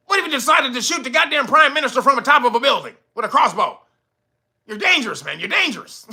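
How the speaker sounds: high, fast sarcastic hypothetical; mock the court's paranoia. Vlogger mocking the judge's fears with high sarcasm.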